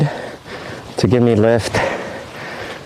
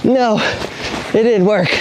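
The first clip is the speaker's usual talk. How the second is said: excited; 9 semitones higher than usual. Real, not acted. Launch attempt fails.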